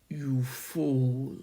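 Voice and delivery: low, very slow unearthly voice; a hollow voice from the grave, dragged out; deep hollow inhuman voice. A narrator's reading. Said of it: "deep, hollow, ... inhuman, disembodied".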